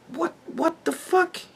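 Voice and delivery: high, slow, pulled-out what the fuck; stunned disbelief. Real, not acted. Creator reacting to a rumour, high stunned disbelief. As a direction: let the disbelief stretch the words.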